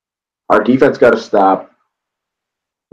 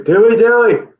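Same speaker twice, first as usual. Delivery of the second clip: high-pitched crowd call; imitated catchphrase call; 12 semitones higher than usual. Unseen guy heard in the crowd, calling out high. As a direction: mimic an unseen fan piping up high.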